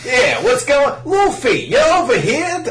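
high, loud cocky dub greeting; dub Sanji voice. Retold or imitated. First try at the dub Sanji greeting Luffy, high and loud. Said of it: "Oh no, it's much more nasally".

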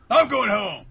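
sudden high, loud shout; Kageura, annoyed outburst. Acted. Kageura quitting the crowded room, acted irritated shout. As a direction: burst out in mock exasperation.

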